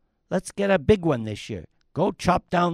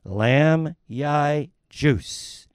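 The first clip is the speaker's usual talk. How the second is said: Lam Yai juice said very slowly; asked again, slowly repeats "Lam Yai juice"; slow clear repeat; much slower than usual. Acted. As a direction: spell out "Lam Yai juice" word by word for someone who didn't catch it.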